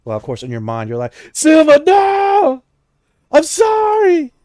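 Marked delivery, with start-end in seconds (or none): 1.3-4.3 desperate shout fading. Acted. Spirit begs Silva not to leave, shout fading to plea. Possessed character pleading, fading.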